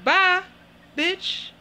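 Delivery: high, loud sarcastic farewell; loud dismissive bye. Real, not acted. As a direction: wave her off loudly. Dismissive send-off.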